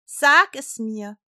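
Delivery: loud, slow, insistent command; in full earnest. A narrator's reading. King presses Esther for the name, in full earnest. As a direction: demand the answer with a heavy first word. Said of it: "in vollem Ernst".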